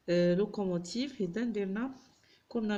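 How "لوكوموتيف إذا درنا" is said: ordinary delivery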